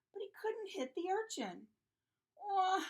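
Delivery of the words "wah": high, loud "wah"; acted toddler cry; yelled baby wail. A narrator's reading. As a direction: bawl like a frustrated toddler. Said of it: "he yelled".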